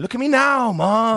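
high, loud, playful boast; mock triumph. Grown student to mother after becoming actor, mock triumph. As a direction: crow it as a mock victory.

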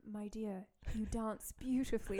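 put-on soft, slow British accent; mock British compliment, slow and soft; imitated British lady. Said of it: "I met a British lady".

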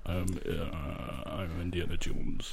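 low, slow, soft mumble; Harrison Ford. Acted. Co-host caps the actor bit, low slow mumble. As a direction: mumble it hesitantly as the film star. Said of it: "Harrison Ford's a mumbler".

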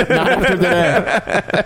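mock doom. Joke about losing film job.

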